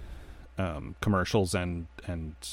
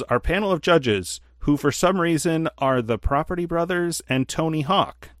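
higher-pitched, announcing like a TV host; mock-grand game show announcement, pitched up; 7 semitones higher, 3 dB louder than usual. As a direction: play the host introducing the judges. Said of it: "he goes".

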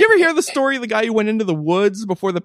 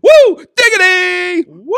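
much higher, louder hype yell; excited whoop; 6 dB louder, slower than usual. Real, not acted. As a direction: whoop it up like a crowd. Hyped cheer for a supporter.

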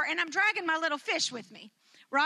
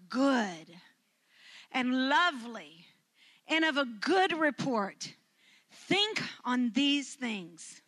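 reverent scripture; slower than usual. Acted. Slow scripture recitation.

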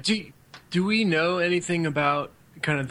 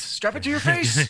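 higher, slower, hammed-up pitch; hammed-up sales pitch; 7 semitones higher than usual. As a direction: stretch it out like a cheesy ad.

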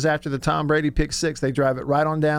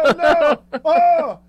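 high, loud mock alarm; shocked gasp; 11 semitones higher, 6 dB louder, much slower than usual. German crew reacting on air, high loud shock. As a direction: imitate the broadcasters' stunned yelp. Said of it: "they were like".

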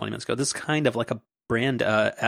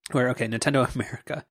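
normal talking voice

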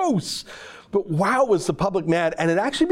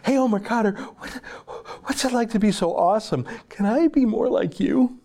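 higher-pitched starstruck gushing, imitated; mock fawning admiration; 7 semitones higher than usual. Imitating an interviewer in awe of Omar Khadr, mock fawning. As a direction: gush in mock deference to a hero. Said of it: "what they were showing by their deference".

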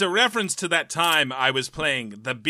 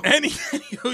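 laughing; 10 semitones higher than usual. Real, not acted. Echoes anywho laughing.

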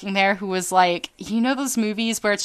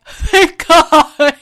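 relieved exclaim; 8 semitones higher, 11 dB louder than usual. Retold or imitated. Relieved reply to counselor.

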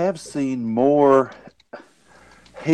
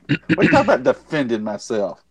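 ordinary delivery

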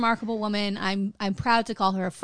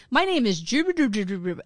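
imitating garbled audio. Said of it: "you just couldn't understand what the guy said".

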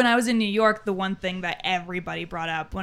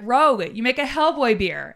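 mock-exasperated, raised emphatic voice; drunk insistence; 4 semitones higher, 5 dB louder than usual. Drunk customer arguing with the beer booth guy, insistent. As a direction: argue the point loudly, punching the first word.

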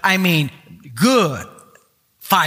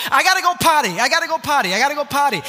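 put-on high-pitched little boy pleading for the potty; a small boy's insistent high whine; child begging; 7 semitones higher, faster than usual. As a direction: whine it like a three-year-old. Son voiced during fireworks.

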